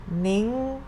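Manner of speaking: "Ning" high and climbing. Shown as a demonstration. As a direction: stretch "Ning" upward. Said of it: "represent the high sound".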